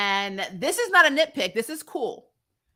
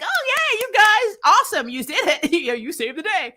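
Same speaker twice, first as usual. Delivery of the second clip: much higher, bubbly and giddy; 9 semitones higher, 4 dB louder than usual. Retold or imitated. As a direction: gush like a giddy kid. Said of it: "she comes back all giddy".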